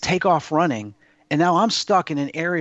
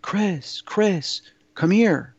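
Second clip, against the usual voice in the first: lightly voiced call, pitched higher; imitated calling voice; 5 semitones higher, much slower than usual. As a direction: retell a voice beckoning a lost child, higher. Mysterious voice calling to child.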